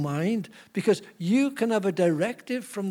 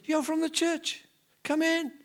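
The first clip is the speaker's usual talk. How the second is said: much higher, eager and astonished; delighted surprise; 10 semitones higher than usual. Retold or imitated. Suicidal lady answers the church visitor, high surprised welcome. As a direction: jump up in pitch with glad disbelief.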